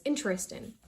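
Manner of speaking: Interesting without the "ter"; one go. Shown as a demonstration. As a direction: say Interesting in three syllables. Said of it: "Just say it in one go".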